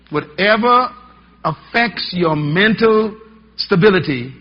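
loud, slow, hammered-out warning; emphatic preaching. Acted. Preacher warning the church about drugs, loud and slow. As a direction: drive every word home to the congregation.

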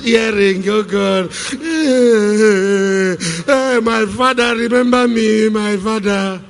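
high, loud hammed-up wailing plea, slow in parts; mocking a believer wailing to God for clothes; mock crying prayer. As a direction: wail to God for shoes and necklaces. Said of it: "crying to".

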